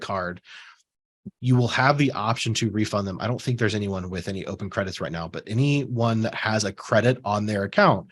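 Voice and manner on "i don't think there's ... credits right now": ordinary talk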